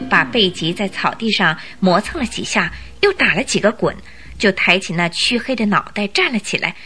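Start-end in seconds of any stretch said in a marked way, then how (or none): none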